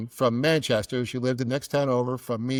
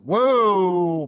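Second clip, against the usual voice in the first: loud, higher, long-held stop call; alarmed whoa; 6 semitones higher, 8 dB louder than usual. Retold or imitated. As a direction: halt him with a big mock-shocked cry. Son halting dad's sex talk.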